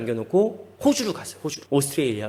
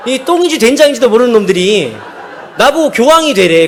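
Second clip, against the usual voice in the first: loud, raised comic grumbling; mock indignation; 6 semitones higher, 9 dB louder than usual. Preacher scoffs at being told to become pope, mock indignant. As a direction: blurt it out loudly, indignant. Acted indignation at friend's mistake.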